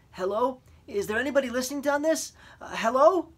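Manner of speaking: high, fast, anxious hello; boy calling anxiously into the mic; nervous call into radio. A narrator's reading. Boy testing the radio, high and anxious. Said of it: "He repeated it two or three times".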